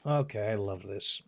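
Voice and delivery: ordinary talk, nothing marked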